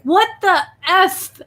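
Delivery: high, loud, slow mock cussing; retold angry outburst. Creator retelling swearing on camera, angry outburst. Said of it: "I was so angry recording that video".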